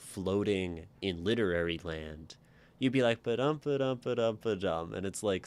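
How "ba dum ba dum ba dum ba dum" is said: Ba-dum beats, sing-songy and light, a bit higher. Shown as a demonstration. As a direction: lilt it lightly like a nursery rhyme. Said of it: "sing-songy and light".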